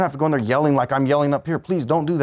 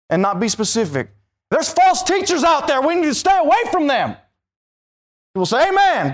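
fiery preaching; 8 semitones higher than usual. Acted. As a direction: hammer it out from the pulpit. Preaching at full force.

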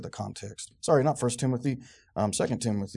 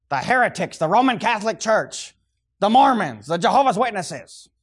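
loud, raised roll call; emphatic listing; 8 semitones higher, 10 dB louder than usual. Real, not acted. Preacher naming who "they" might be, emphatic listing. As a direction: call out each group forcefully. Calls out mock wrong answers.

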